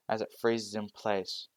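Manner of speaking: ordinary delivery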